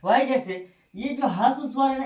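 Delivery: usual speaking voice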